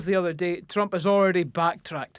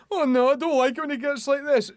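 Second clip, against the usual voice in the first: mock scared mum; 7 semitones higher than usual. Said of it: "Starmer's the mum that's".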